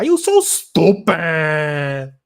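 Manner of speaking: loud, very drawn-out mock scolding; torta woman impression. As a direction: stretch it out like an exasperated woman. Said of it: "my fucking torta impression".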